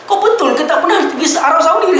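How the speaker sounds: high-pitched mock astonishment, edged with laughter; retold disbelieving question. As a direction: quote an amazed sheikh, half laughing. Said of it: "Sampai Syekh tu kata".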